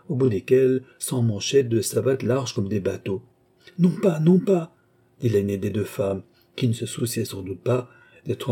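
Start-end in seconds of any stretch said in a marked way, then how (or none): 3.8-4.7 quick refusal. A narrator's reading. Lady refuses the guide.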